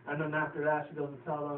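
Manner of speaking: usual speaking voice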